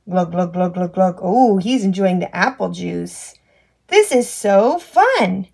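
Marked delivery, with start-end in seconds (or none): 0.1-1.2 low glugging. Pretend. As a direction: lower gulping glug sounds. Drinking sound voiced.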